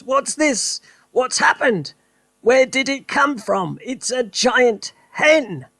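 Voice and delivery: high, agitated questions; excited father. Acted. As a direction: fire them off worked up. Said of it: "he cried, waving his arms".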